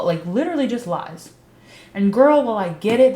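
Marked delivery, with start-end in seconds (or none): none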